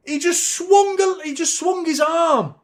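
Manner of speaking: high, loud outraged cry; outraged shout. Real, not acted. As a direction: yell it in disbelief at the foul. Reacts to the elbow.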